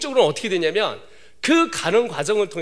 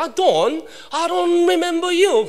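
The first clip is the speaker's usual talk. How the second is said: put-on British accent; 9 semitones higher, much slower than usual. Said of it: "영국 사람이니까".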